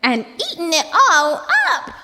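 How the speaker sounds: a little bear near tears; Baby Bear wailing. A narrator's reading. Baby Bear wails his porridge is gone, high and loud. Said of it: "squeaked Baby Bear".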